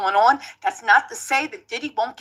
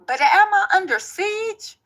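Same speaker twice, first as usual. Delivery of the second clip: put-on Tony Busby accent; 2 semitones higher than usual. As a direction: play a smug Texas lawyer with a light Southern accent. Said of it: "my Tony Busby accent is impeccable".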